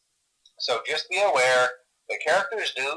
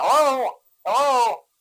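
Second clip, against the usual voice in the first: higher, louder hello on the line; higher hello, hello into the phone; calling hello; 11 semitones higher, 5 dB louder, slower than usual. Acted. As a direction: check if anyone's there.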